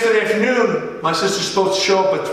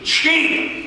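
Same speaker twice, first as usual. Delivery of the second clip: Cheap! punched out high; emphatic cheap; 10 semitones higher than usual. Real, not acted. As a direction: hit "Cheap!" hard and high. Stressing the dad was cheap.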